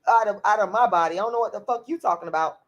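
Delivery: ordinary talk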